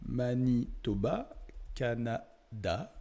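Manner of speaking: "Manitoba, Canada" drawn out very slowly, syllable by syllable; dictating while typing. Real, not acted. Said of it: "on va marquer". (« Manitoba, Canada » dicté lentement, syllabe par syllabe)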